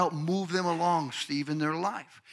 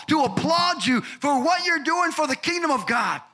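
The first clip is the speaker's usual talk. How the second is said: loud, high climax shouting praise; full-force preacher's shout of God's reward; preaching at full force; 10 semitones higher, 5 dB louder than usual. Real, not acted. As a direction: build to a shouted peak of praise.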